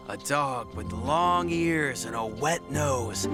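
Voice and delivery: ordinary delivery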